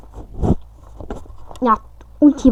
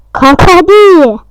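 pleading urge; 5 semitones higher, 10 dB louder than usual. Real, not acted. Urging teammate in game.

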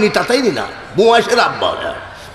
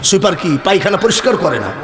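normal talking voice

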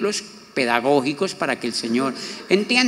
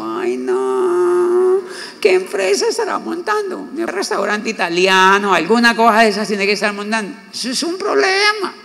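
much higher, put-on whiny falsetto; mocking inner voice; 6 semitones higher than usual. To himself doubting the son's business, in a high mock falsetto. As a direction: squeal it like a worried busybody.

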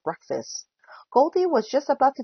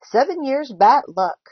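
louder, higher lament; storybook dismay, punched out; dismayed exclamation; 4 semitones higher, 7 dB louder than usual. A narrator's reading. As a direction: exclaim over the terrible luck. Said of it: "Goldie exclaimed".

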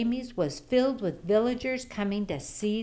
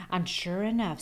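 usual speaking voice

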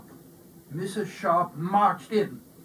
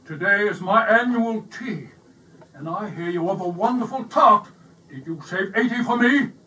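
louder, demanding bully voice; bossy; 3 semitones higher, 8 dB louder than usual. A narrator's reading. Bully barges in.

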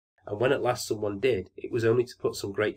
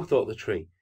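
usual speaking voice

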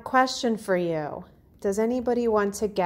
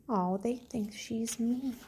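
softer, surprised gasp then hush; hushed aside; 3 semitones higher, 9 dB quieter than usual. Real, not acted. Teacher gasping softly to the class about the animals.

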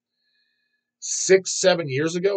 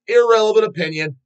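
high, loud dismissive outburst; mock scolding shout; mock outraged shout; 9 semitones higher, 9 dB louder than usual. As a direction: blast it at the imaginary kid. Mock rant at posers.

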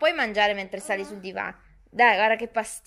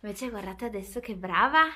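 ordinary delivery

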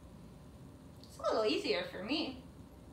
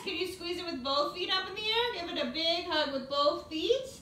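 ordinary delivery

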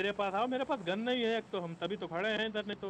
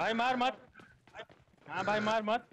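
short, higher burst of protest; urgent pleading; 3 semitones higher than usual. Real, not acted. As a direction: plead sharply to hold fire. Pleading with player shooting him. (ऊँचे सुर में गुहार)

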